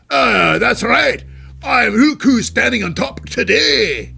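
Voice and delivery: loud, high, slow hammed-up Scottish accent. Vlogger voices Drew McIntyre gloating in a loud Scottish accent. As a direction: crow it in a big Scottish brogue. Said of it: "love doing the Scottish accent".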